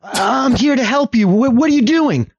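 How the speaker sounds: high-pitched woman's character voice; put-on high voice, halting an intruder; woman calling down.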